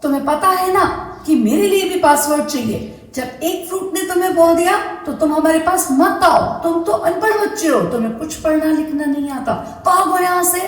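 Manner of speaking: high, fast scolding shout; angry mango shouting. Acted. Mango yells at illiterate Chinku, high and fast. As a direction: shoo the boy away in a rage. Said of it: "बनाना चिल्लाया".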